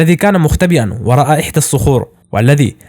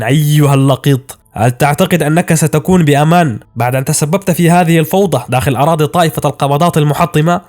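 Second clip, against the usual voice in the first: plain reading voice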